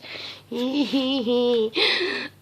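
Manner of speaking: high, giggly squeal; delighted squeal. Real, not acted. Grandma gushing over the sailor dress, delighted giggle.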